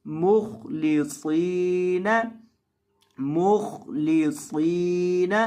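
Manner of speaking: मुखलिसीन with thick kh and s and a long si; heavy letters. Shown as a demonstration. Teacher demonstrates for students, slow heavy recitation. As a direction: draw out मुखलिसीन slowly, heavy kh. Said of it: "खौ को भी और सौद को भी दोनों को आपने मोटा करना है".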